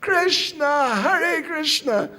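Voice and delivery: high, loud joyful-sounding chant call. Acted. Ladies all calling to stop Nimai crying, loud call. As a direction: call the holy name out loudly. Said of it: "they all called out".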